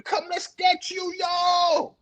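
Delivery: high hollered call; imitating a friend yelling from afar. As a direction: holler it high across a field. Acts friend calling out.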